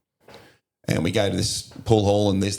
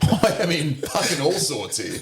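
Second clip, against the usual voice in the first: slow, high, laughing through it; 8 semitones higher, slower than usual. Real, not acted. Recalling being in trouble in the fight, laughing. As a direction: let the laugh carry the words.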